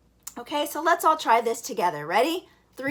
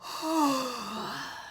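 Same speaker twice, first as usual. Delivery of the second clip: yawn breath; 7 dB quieter than usual. Shown as a demonstration. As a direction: let out a long easy yawn sigh. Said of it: "when you yawn".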